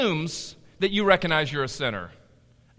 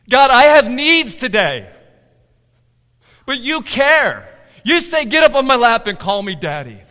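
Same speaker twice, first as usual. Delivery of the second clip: urgent plea; 6 semitones higher, 11 dB louder than usual. Acted. Believer crying needs out to God, loud urgent plea. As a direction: cry out with full conviction.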